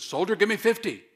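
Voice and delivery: loud barked drill order; drill sergeant. Pretend. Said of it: "sticks his nose in Don's face and said".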